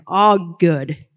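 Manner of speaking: usual speaking voice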